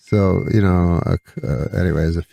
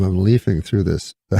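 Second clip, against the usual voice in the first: usual speaking voice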